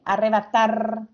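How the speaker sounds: slow "arrebatar", weight on final TAR; "arrebatar" spoken slowly for listeners, stressing TAR; stress on final syllable. Shown as a demonstration. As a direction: draw out "arrebatar" so the last syllable stands out. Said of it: "Escuchen bien".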